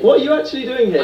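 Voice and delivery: surprised. Real, not acted. Prank revealed, interviewer shocked.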